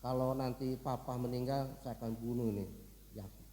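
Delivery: low, grudging vow; quietly vengeful. Acted. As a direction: nurse a grudge under the breath. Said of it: "dia agak dendam juga".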